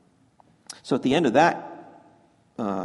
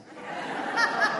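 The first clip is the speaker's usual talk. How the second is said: quick airy chuckle; short high laugh. Real, not acted. Priest laughing at kids saying no apples, airy chuckle. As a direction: laugh at the joke.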